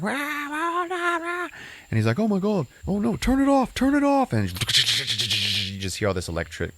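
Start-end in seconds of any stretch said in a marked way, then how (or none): 0.0-1.5 high-pitched mock shriek; shrill hammed-up screams; mock screaming. Patient screaming under electroshock, high mock shriek. And she's like. 2.2-4.3 much higher panicked cry; hammed-up frantic alarm; mock panic. Doctor panicking as the patient is electrocuted, high mock panic.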